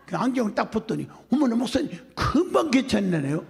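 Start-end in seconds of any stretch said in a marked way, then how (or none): 1.3-3.4 imitated amazement. Woman's amazed reaction, acted out.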